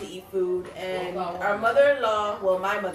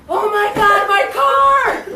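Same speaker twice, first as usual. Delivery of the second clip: much higher, loud mock panic; panicked shout; 11 semitones higher, 13 dB louder, slower than usual. Boyfriend after crashing into a pole, yelling in panic. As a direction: wail about the car.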